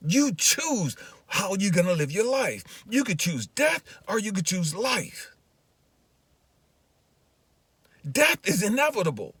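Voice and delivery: high, forceful preaching voice; God's urgent charge, preached hard; impassioned exhortation. Retold or imitated. God's exhortation preached at full pitch.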